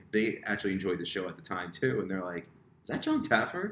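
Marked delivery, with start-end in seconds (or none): 2.8-3.7 pitched up high, is that John Taffer; excited recognition. Retold or imitated. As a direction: gasp it out like spotting a celebrity. Parents spot the celebrity.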